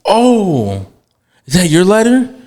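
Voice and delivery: high imitation of a man catching on; mock surprise, pitched well up; dawning realization. Imitating the man he almost hit noticing the ladder, surprised.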